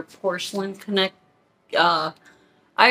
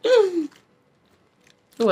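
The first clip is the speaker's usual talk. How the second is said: delighted gasp; 10 semitones higher, 3 dB louder than usual. Real, not acted.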